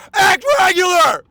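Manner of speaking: loud, high exasperated yell; ranting at theater actors, high and loud; shouting. Real, not acted. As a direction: shout the demand in frustration. Ranting against theater acting.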